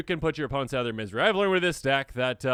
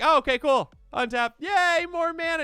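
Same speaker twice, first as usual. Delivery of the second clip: mock delight; 13 semitones higher, 5 dB louder than usual. You're like.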